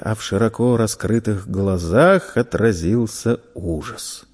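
plain reading voice

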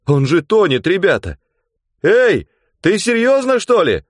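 high, loud alarmed calls; alarmed shouting. Acted. Onlookers on the shore call out in alarm.

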